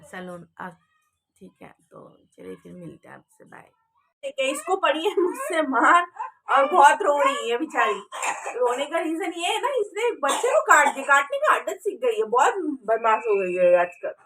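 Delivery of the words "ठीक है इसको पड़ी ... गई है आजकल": loud running explanation over crying; raised voice. Real, not acted. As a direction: speak up over the wailing.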